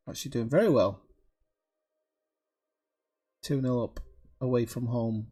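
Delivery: ordinary talk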